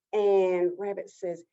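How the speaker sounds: ordinary delivery, nothing marked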